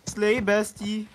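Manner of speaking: slow, drawn-out mock praise; ironic zoomer. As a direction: stretch it out, teasing and ironic. Teasing praise after the kill.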